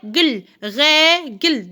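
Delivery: "règle" slow, in two beats; syllable by syllable. Shown as a demonstration. Teacher counting syllables for children, slow separate beats. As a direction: stretch the first syllable of "règle", then the second. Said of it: "deux syllabes".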